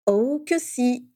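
slow, stretched out delight; gleeful. Acted. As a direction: relish every syllable. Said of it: "se réjouit James".

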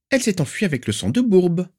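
put-on very high, sharp, demanding old aunt; shrill aunt. Aunt demanding where the niece is, shrill and stern. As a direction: pinch every word with disapproval. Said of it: "l'informa-t-elle".

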